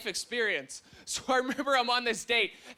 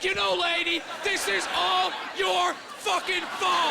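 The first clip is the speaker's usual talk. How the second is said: 1 vengeful yell; 7 semitones higher than usual. Retold or imitated. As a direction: fling the blame at her before the train hits. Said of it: "look her in the eyes and be like".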